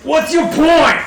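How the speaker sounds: high, loud retort; hammed-up scornful shout; defiant adventurer. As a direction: throw it back at the archer. Player retorts to archer in character.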